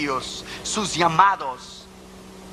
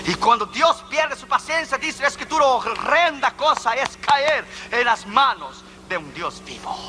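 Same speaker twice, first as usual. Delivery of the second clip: high, emphatic pulpit warning; preaching warning; 7 semitones higher than usual. Real, not acted. Preacher warns that God loses patience, emphatic preaching. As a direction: proclaim it as a dire warning from scripture.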